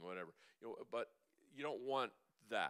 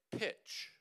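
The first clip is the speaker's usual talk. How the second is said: higher, breathy pitch; short demo word; 9 semitones higher, 4 dB louder than usual. Shown as a demonstration. Coach demonstrating the word in the throat, breathy. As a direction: clip it off with air. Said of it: "I want you to say".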